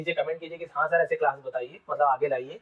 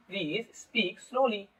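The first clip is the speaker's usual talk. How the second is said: slow, softer demo of please speak slowly; spoken slowly; 4 semitones higher, 4 dB quieter, slower than usual. Shown as a demonstration. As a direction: draw out each word of please speak slowly as you teach it. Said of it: "धीरे धीरे बोलो". (Please speak slowly धीरे धीरे बोलकर)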